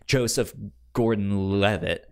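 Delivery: usual speaking voice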